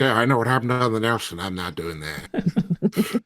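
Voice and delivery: a light put-on goblin-guy accent, slowing down; lightly voiced goblin accent, drawn out at the end; Bond's goblin accent. Player mimicking Bond the goblin guy in goblin accent. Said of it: "I don't know why I put that accent on".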